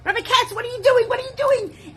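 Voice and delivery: high, loud, urgent cries of protest; children's scream. Retold or imitated. Children screaming at the rabbi to stop the fire, frantic high yell. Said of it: "the children started to scream".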